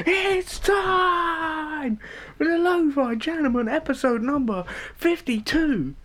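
high, slow announcer whisper-shout. Acted. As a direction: announce it in a strained half-shout. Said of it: "you whisper shout it every single time".

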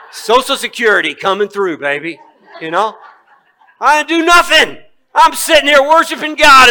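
high, loud, laughing delight; gleeful laughing. Acted. Preacher jokes to congregation about free money, high loud laughing glee.

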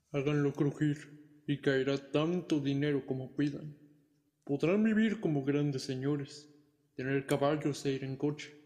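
slow, sly devil dragon; friendly dragon voice. Acted. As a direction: tempt them slowly, like a dragon. The devil-dragon sets his deal.

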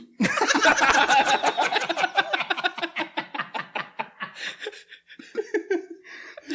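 big high-pitched laugh; long high laughter. Real, not acted. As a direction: crack up helplessly. Joke about uncle lands. (carcajada larga y aguda)

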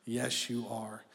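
soft, low, slow and tender; a loving father's quiet yes; gentle reassurance. Acted. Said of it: "let's let God bend his ear down and go".

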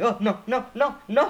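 high, loud imitation of a refusing dad; mock emphatic refusal. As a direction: act out dad's rapid-fire protest. Dad's refusal to pick up hitchhikers.

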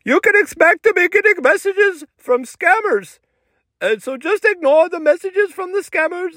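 high-pitched put-on salesman voice; high, strained character voice, stretched at the end; tech guy Sushel. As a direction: pitch it way up as the Instagram promoter. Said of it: "And he said".

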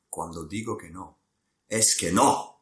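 Spanish spoken with a poor accent. Shown as a demonstration. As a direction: attempt a Spanish accent, firming up at the end. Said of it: "Forgive my poor Spanish accent".

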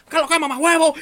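high, loud angry Dominican uncle; Dominican accent. Retold or imitated. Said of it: "todo lo dominicano".

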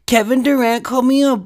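put-on high, loud whining little-kid voice; mock him as a crybaby tattling child; whiny Kendrick Perkins. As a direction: pitch it way up and whine like a tattletale. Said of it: "It's gonna be like that fucking".

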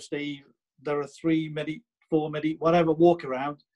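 usual speaking voice

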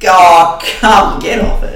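high, loud scoffing objection; incredulous mock-quarrel outburst; exasperated protest.

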